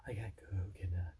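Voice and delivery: low hushed spooky imitation; mock scared. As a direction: imitate a frightened whisper. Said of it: "Like you're afraid".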